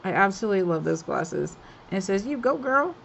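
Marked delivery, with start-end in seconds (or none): 2.3-2.9 put-on "You go, girl." lido com atitude atrevida, mais alto e agudo; sassy voice. Shown as a demonstration. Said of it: "I have to read it in my sassy voice".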